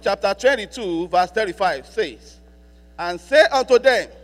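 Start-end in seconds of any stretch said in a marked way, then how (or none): 3.0-4.1 slow, solemn Bible reading drawn out; scripture reading. Acted. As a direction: read each phrase slowly like holy text. Reads scripture slowly over music.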